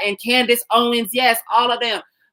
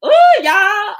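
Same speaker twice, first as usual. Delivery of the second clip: excited whoop; 11 semitones higher, 6 dB louder than usual. Real, not acted. Excited praise of comrade.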